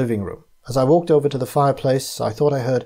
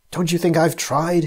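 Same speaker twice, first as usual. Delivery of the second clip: a despairing, demanding question, pitched higher; 6 semitones higher, 3 dB louder than usual. A narrator's reading. Sir Henry demanding to know, despairing. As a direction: push back at them, half pleading. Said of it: "he demanded".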